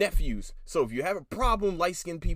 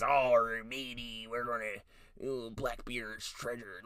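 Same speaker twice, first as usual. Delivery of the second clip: mock greedy pirate; 4 semitones lower, 5 dB quieter, slower than usual. Mocking pirates' eager chatter.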